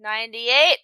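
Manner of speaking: ordinary delivery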